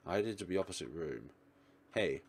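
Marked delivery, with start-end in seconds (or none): none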